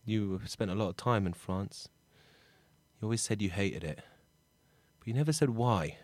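soft, low musing with long pauses; subdued, missing his dad; wistful talk to absent dad. Acted. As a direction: speak privately to a father who is gone. Character addresses absent dad.

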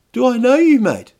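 high, loud, suspicious challenge; passive aggressive. Acted. Guy trying to recognise the man in the costume, passive aggressive. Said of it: "a bit aggressive, passive aggressive".

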